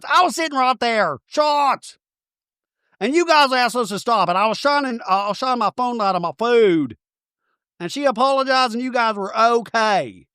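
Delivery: high, put-on valley-girl voice; mocking Brittany voice. Brittany quoted in recap.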